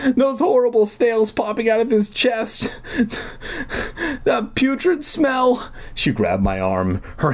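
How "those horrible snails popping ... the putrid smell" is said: wailing. Acted. Deirdre wailing about the monster's smell. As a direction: wail about the stench.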